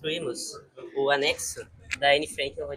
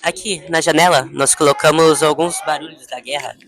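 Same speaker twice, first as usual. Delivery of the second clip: louder, presenting over the room; projecting voice; 8 dB louder than usual. Real, not acted. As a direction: raise the voice for the crowd. Presenting to visitors over noise.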